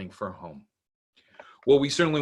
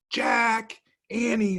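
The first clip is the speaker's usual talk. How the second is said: calling from afar; 12 semitones higher, much slower than usual. Acted. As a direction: call out to kids far away. Mom calling from distance.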